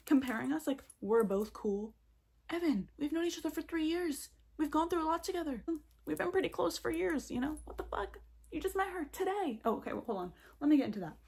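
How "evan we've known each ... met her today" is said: high-pitched, exasperated appeal to a friend; indignant protest. Acted. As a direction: plead your case in a high, offended voice. Indignant acted address to absent Evan.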